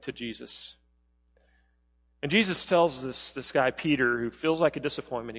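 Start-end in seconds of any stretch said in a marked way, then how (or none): none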